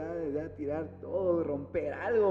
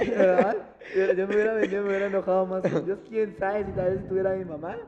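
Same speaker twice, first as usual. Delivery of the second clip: laughing; 2 semitones higher, 7 dB louder than usual. Real, not acted. Laughing agreement, fast and loud.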